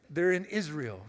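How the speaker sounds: ordinary talk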